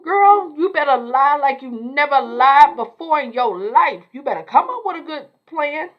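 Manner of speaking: squealing, loud and high alarmed friend; high-pitched alarm. Retold or imitated. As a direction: shriek it at her in disbelief. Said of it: "Fatima's like".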